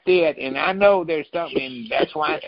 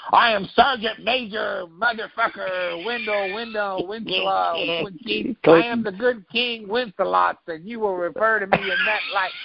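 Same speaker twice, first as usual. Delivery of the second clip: puffed-up, self-important officer mocked higher; pompous officer; 6 semitones higher than usual. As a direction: lord it over the room as a grand title-holder. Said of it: "the Republican desk goes".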